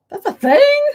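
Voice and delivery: can't believe it's real; incredulous. Real, not acted. Reacting in disbelief.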